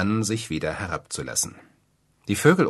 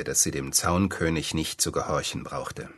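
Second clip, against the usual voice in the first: ordinary delivery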